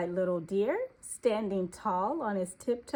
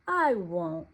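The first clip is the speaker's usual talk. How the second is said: ordinary delivery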